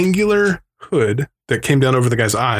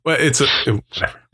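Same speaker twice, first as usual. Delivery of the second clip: normal talking voice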